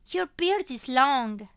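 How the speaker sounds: put-on loud, slow toddler voice; a small child noticing stubble; little girl observing. A narrator's reading. Little girl rubbing her father's cheek, observing.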